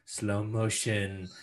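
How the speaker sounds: slowed, drawn-out narration; mock slow-mo. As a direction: stretch the words like slow motion. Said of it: "his one opportunity to be like".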